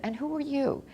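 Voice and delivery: a hint of the mother's lost, polite question; mild mock of a confused mother asking; imitating confused mother. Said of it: "especially my mother saying".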